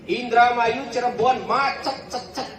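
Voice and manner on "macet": ordinary talk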